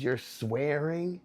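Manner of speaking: slow, high scolding-mom voice; mock a scandalized mother; imitated mom. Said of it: "She goes".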